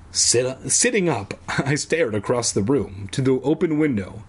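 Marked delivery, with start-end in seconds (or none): none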